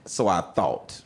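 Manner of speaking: ordinary talk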